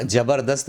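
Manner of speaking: 'ज़बरदस्त' starting with j instead of z; common mispronunciation. Shown as a demonstration. Teacher quotes what people say, mispronounced. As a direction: say 'ज़बरदस्त' as jabardast. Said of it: "ज़बरदस्त बोल देते हैं".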